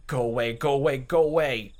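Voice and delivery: loud, high furious shout. Acted. Keiichi shouting at Rena, loud. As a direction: bark her away.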